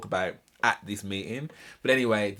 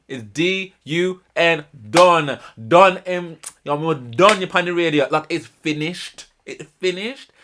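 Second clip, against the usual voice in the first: loud, higher, spelling it out slowly; emphatic loud verdict; 6 semitones higher, 8 dB louder, slower than usual. Real, not acted. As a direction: hammer home that it's over. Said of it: "emphatic spelling out, loud".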